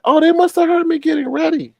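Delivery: light high-voiced imitation; Tracy's voice. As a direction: play it naive and breezy. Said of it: "she was like".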